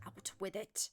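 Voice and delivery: put-on low-register Irish accent; Maeve's Irish lilt, pitched low. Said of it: "Irish accent in a lower register".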